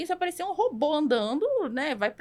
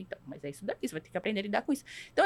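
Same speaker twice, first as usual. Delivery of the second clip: normal talking voice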